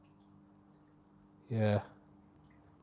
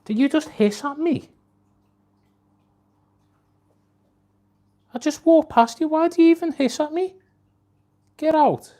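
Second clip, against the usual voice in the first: high, loud exasperated telling-off; much higher and louder telling off the cat; scolding the cat; 11 semitones higher, 11 dB louder than usual. Real, not acted. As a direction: shoo the cat away indignantly.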